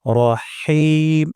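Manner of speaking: রহিম drawn out slowly, হি from the throat; throat ha demo. Shown as a demonstration. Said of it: "উচ্চারণ করব রহিম". (ধীরে রহিম উচ্চারণ)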